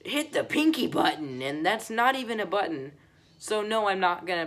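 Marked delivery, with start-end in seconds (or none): 0.0-1.4 higher mocking imitation of a YouTuber; raised-pitch send-up of a challenge; mock YouTuber plea. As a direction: parody a like-begging creator. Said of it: "Now it's just, like".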